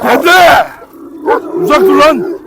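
loud, high warning shout; scolding shout. Real, not acted. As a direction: drive the dog back with your voice. Yelling at dog to back off. (köpeği azarlayarak bağırma)

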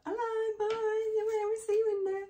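parents calling hello. Retold or imitated. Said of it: "Mum and Dad like".

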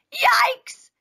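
high-pitched startled yelp. A narrator's reading. Father turns round to the loaded cart, startled.

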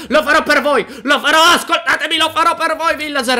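heroic promise. Acted. Player vowing to the villagers, loud mock-heroic. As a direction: swear it like a hammed-up hero.

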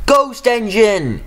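high, slow, drawn-out shriek of fright; terrified shriek. A narrator's reading. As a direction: scream it as you flee the ghost. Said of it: "he shrieked".

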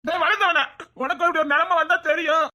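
high-pitched, aggrieved outburst; upset retort. Acted. Snaps back at teasing friend.

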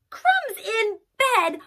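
really offended now; high, loud disbelief; outraged repeat. Acted. Child repeats, more indignant.

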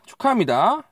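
bright rising congratulations; booming prize announcer. Real, not acted. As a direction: announce the winner with cheer. Announcing giveaway winner.

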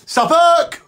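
high loud retold yell; shouting Suffolk. Prisoner in the food queue yelling, high and loud. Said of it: "they used to say with me".

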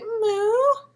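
a high, squeaky attempt at a moose call; a bunny trying to moo like a moose, high; failed moose call. Bunny copying the moose, high squeaky moo. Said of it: "P J's trying to make the same noise".

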